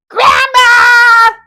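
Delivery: high, loud call across the house. Acted. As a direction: shout it to another room. Calling grandma from afar.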